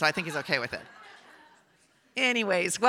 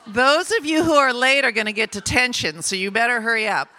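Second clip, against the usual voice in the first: loud, raised call to a crowd; 6 semitones higher, 8 dB louder than usual. Real, not acted. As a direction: round up the stragglers loudly, playfully scolding. Calling latecomers in from outside.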